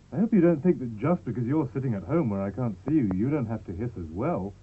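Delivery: ordinary talk, nothing marked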